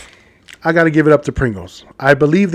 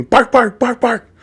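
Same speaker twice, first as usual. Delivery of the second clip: higher-pitched yapping bark; barking dogs; 8 semitones higher than usual. Acted. Joke teller playing dogs at the third tree, barking. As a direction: imitate dogs with quick repeated barks. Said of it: "the dogs are barking".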